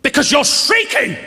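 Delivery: high, loud mock shriek; retold shriek. Snapping back at Linda, a high loud mock shriek. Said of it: "I can't hear you".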